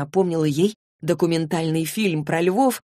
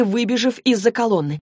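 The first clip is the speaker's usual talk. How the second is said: plain reading voice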